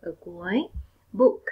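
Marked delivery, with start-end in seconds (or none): 1.1-1.4 Book with the final k clearly released. Shown as a demonstration. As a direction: pop the k at the end of Book. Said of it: "em cần nói được bật âm k ở cuối".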